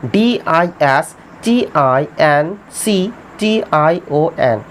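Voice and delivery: slow, letter-by-letter dictation; measured dictation of each letter; spelling out letters. Shown as a demonstration. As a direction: spell it slowly for the class. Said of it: "spelling देखिए अच्छे से ध्यान दीजिए".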